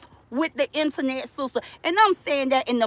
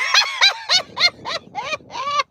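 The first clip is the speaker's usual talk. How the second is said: shrill-pitched cackle after the punchline; high cackling laugh. Real, not acted. Laughing at her own joke.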